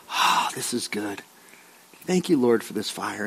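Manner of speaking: low, soft sigh of relief; contented relief. Acted. Preacher as Paul thanking God at the fire, softly relieved. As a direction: murmur thanks, sinking very soft.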